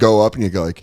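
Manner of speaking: ordinary talk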